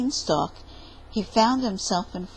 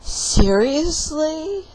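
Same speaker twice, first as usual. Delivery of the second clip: slow, drawn-out, louder seriously; exasperated; 7 semitones higher, 6 dB louder, much slower than usual. Acted. Fed-up reaction to the tiny beanstalk, slow and exasperated. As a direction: stretch it out in disbelief.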